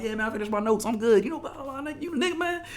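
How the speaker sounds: breezy catching-up with mom on the phone; chill phone chatter. Retold or imitated. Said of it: "chill, I'm talking to my mom".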